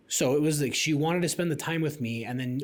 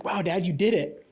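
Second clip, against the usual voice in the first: lightly voiced daughter, higher; proud kid; 5 semitones higher than usual. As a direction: say it like a proud child.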